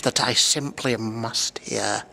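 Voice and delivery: low, slow gloating voice; grinning Grinch. Acted. Grinch on the mountaintop, low slow gloat. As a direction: savour it like a treat. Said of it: "grinned the Grinch".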